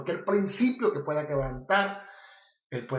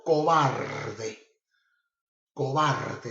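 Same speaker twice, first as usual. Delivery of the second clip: a stern voice of God, louder; a preacher voicing God as he scolds; God rebuking; 4 dB louder, much slower than usual. Preacher voicing God as He calls Paul a coward, stern and louder. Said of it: "escuchó la voz de Dios cuando le dijo".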